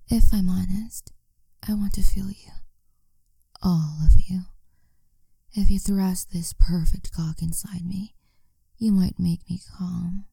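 pushing out the words with desire. Acted. Rising desire, louder lines.

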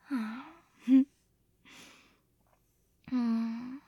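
normal talking voice

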